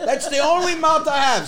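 mocking mimicry. Son parroting dad's only mouth line, mocking mimicry.

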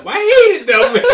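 very high, loud, half-crying with laughter; laughing exasperation. Real, not acted. [name] recalling the needling, loud laughing exasperation. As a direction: blurt it out, laughing helplessly.

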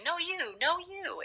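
lightly voiced polite stranger; polite Southern driver. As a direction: wave the other driver on. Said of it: "four-way stop with somebody".

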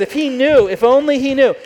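mock him, if only he knew; scoffing. Pharisee scoffing at Jesus, high loud sneer.

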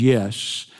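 a touch of irritated snob; annoyed rich driver. Acted. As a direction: humour him through your teeth. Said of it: "The driver of the Rolls looks annoyed".

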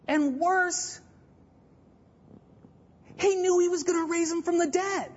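high-pitched, incredulous proclamation with pauses; incredulous preaching. Real, not acted. Preacher revealing Jesus knew he'd raise Lazarus, incredulous. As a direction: deliver the twist high and disbelieving.

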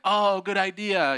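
high, slow, exaggerated agreement; mock agreement. Sisters humouring their brother's idea, mock agreement. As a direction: draw it out with fake sincerity.

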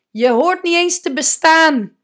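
a harsh voice crushing someone's worth; extra loud. Shown as a demonstration. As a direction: hit it hard so it stings. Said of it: "ik zeg dat even extra hard".

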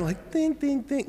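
high sing-song ding ding ding; mock bell tones, high and light; imitating bells. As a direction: chime it like a little xylophone. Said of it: "xylophone thing called the bells".

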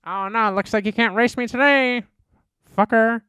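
high, loud hammed-up challenge; mock racer taunt. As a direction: goad him into a race, over the top. Mock cop race taunt continues.

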